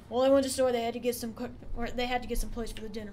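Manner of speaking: imitating a brother's fast, run-together speech; quick, slurred impression of brother; brother's Boomhauer-like mumble. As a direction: rush the words into one another like Boomhauer. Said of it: "do an impression of my brother".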